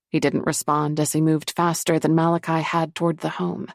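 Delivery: plain reading voice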